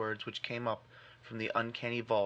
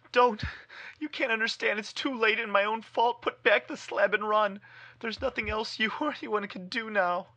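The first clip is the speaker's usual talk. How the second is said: much higher, loud desperate scream; scream of despair; 10 semitones higher, 6 dB louder than usual. Acted. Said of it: "changed to a scream of utter despair".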